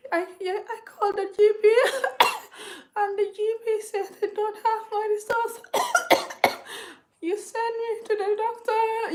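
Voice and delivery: imitating a sick, high-pitched, coughing voice; struggling to talk. Sick caller struggling to explain to the phone line, high strained voice. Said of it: "this is how I was talking".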